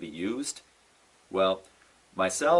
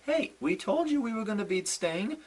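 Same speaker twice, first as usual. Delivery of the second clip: higher, put-on smug voice; mock Triple H and Stephanie gloating; 11 semitones higher than usual. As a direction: mimic their gloating. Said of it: "they're like".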